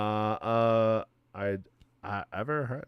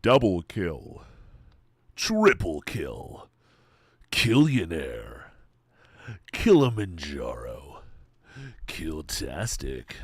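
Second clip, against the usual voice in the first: mock announcer; 5 semitones lower, much slower than usual. Game announcer kill streak voice.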